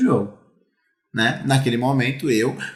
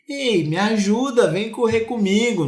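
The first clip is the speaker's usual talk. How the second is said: innocent; 7 semitones higher than usual. Retold or imitated. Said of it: "na maior inocência".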